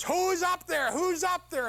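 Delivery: high, loud panicked calling; frantic shout. Acted. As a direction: yell up in disbelief. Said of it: "And he says".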